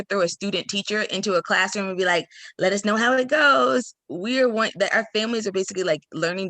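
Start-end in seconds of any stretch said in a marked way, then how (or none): 2.6-3.9 higher, louder hands-off farewell; played-up carefree goodbye; mock breezy send-off. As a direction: call it out like abandoning a rookie. Mock send-off to student teacher.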